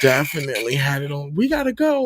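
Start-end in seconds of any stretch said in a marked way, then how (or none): 1.3-2.0 high, louder hurry-up call; imitated impatient parent. Parent rushes a gaming child, mock impatient call. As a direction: mimic a parent calling time on the game.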